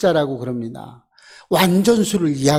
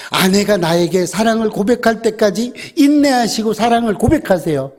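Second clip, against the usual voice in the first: normal talking voice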